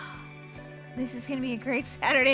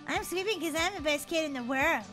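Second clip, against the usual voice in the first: mocking mimicry; 5 dB quieter than usual. [name] copying Daisy's boast, mocking. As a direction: mimic a little sister's chant. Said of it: "don't mock me".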